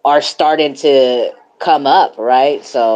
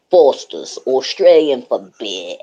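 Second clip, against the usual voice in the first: mock Aussie accent on a beer slogan; hammed-up Aussie ad-line accent; Australian accent; 2 semitones lower than usual. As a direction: lay on a cheeky Australian accent. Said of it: "my favorite Too Cold voice".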